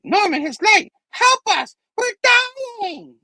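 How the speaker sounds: very high, strained mock scream; desperate dying plea. Dying Goblin begging Norman for help, high panicked wail. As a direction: shriek it as a hammed-up dying villain.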